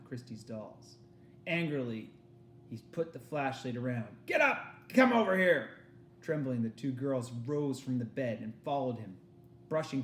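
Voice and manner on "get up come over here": officer shouting. Acted.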